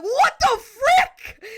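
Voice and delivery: high, slow, drawn-out shout; mock freak-out. Substitute freaking out at the flood, mock outraged yell. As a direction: imitate a teacher losing it, hammed up. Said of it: "freaking out".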